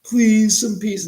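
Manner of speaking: high, loud mock plea for the dog; voicing the dog. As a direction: beg like the tired old dog. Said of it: "this dog is just gonna, like, going".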